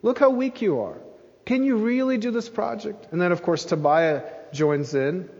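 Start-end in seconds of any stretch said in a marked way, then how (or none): 0.0-3.1 higher, sneering jeer at the builders; mocking taunt. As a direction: taunt them, mock how weak they are. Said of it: "He's mocking them".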